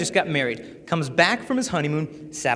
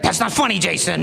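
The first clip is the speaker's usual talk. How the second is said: mock indignant teacher, pitched up; scolding teacher. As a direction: imitate a teacher telling off a joker. Retold teacher scolding the joker.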